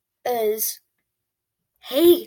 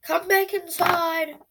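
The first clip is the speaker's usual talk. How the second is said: imitated call; 7 semitones higher, slower than usual. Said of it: "Not actual like that".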